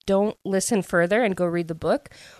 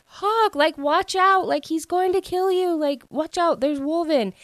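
much higher, acted-out alarm; urgent retold warning; 11 semitones higher than usual. Poppy warns Hawk he is in danger, high-pitched mock alarm. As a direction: imitate a panicked cry of warning, pitched way up.

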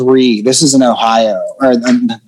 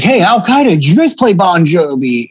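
higher-pitched retold taunt; mock heckling; 5 semitones higher than usual. Comic retelling a stranger calling him from the next table, mock heckling.

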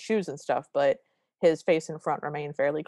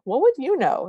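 retold scoff; 9 semitones higher, 6 dB louder than usual. Mother scolding, retold.

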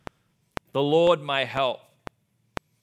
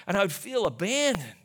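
ordinary delivery

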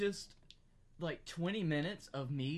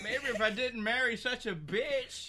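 mock husband; 5 semitones higher than usual. Voicing Clarence answering wife.

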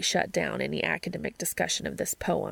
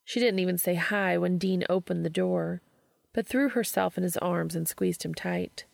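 plain reading voice

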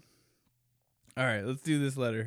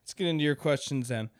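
usual speaking voice

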